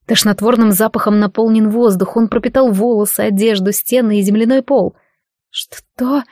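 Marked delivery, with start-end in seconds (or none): 5.5-6.2 dazed, halting stammer; faint, squeezed-out question. A narrator's reading. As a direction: stumble on the first sound, softly. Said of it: "заикаясь, выдавила из себя она".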